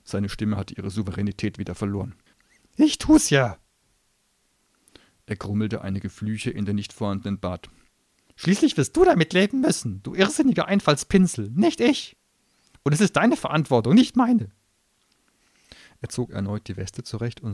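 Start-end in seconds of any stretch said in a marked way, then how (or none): 2.8-14.5 high, loud sneering gnome voice; a cornered little demon spitting scorn; shrill gnome tirade. Acted. Gnome grumbling concession.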